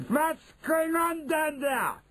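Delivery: high, slow witch leader's cry; imperious shout. Acted. Grand High Witch to the floor, high shouted demand. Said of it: "shouted the Grand High Witch".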